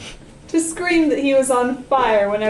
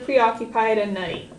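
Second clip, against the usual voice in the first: plain reading voice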